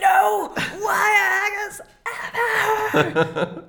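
high, loud hammed-up shriek; mock scream. Joking about Joan Crawford, a loud high mock scream. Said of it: "I can't scream".

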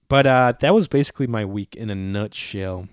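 ordinary talk

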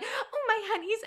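very high, soft sing-out; mock cooing. Playfully disrupting housemates working from home, high mock coo. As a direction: coo it high and softly, hamming it up. Voicing herself interrupting housemates' meetings.

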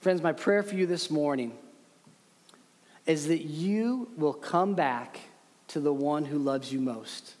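ordinary talk

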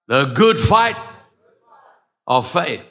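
high, loud call to the congregation; preacher's call. Real, not acted. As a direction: lead the crowd in a rousing chant. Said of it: "Everybody say".

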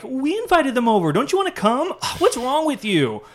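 mock the sisters as spoiled and offended; whiny accusation. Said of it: "and they're like".

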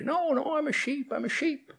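high, soft frightened insistence; high, meek protest; protesting little sheep lion. Acted.

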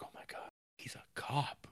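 hushed whisper of shock; a frightened whisper to herself; whispered gasp. Retold or imitated. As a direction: breathe it out, barely voiced. Whispered realization retold.

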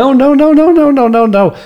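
high, loud frantic refusals; panicked no. Acted. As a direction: cut him off in a panic.

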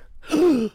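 sharp loud high gasp; a whole car sucking in air at once; collective gasp. Retold or imitated. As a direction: gasp hard at the sudden stop sign. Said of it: "there was a collective".

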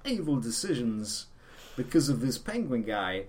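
halting impression with odd emphasis; Christopher Walken. Correcting a friend about a Batman film, halting actor impression. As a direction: break the sentence up with lilting, odd pauses. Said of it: "wasn't that Christopher Walken?".

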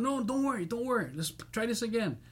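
high-pitched mock reassurance; imitated placating promoter. Promoter calms the upset singer, mock high-pitched reassurance.

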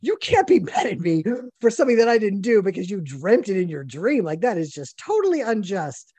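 high, pleading indignation; loud, high complaint that it isn't fair; indignant protest. Retold or imitated. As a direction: protest the unfairness of it. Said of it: "And I'm like".

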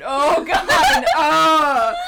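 loud, high flustered oh god with a laugh; flustered exclamation. Real, not acted. Friend put on the spot, loud flustered exclaim. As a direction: caught off guard, cry out loud.

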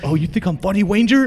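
high, loud sneering instructor yell; mock him like a lisping instructor; mocking drill instructor. Ranger instructor taunting a laughing lieutenant, high mocking yell. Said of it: "laugh at your R I when he has a lisp".

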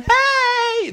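put-on high shouted kid call. Kids peeking behind the curtain, calling out in a high shout. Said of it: "the kids are peeking around".

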